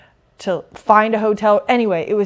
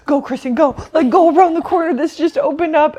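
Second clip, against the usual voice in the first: urgent; 8 semitones higher, 5 dB louder than usual. Retold or imitated. Urging friend to hurry.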